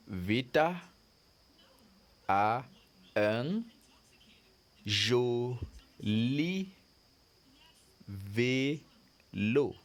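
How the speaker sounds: Vita a un joli vélo, each word split by a pause; word-by-word reading. Shown as a demonstration. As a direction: read it to the class word by word. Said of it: "So look at it".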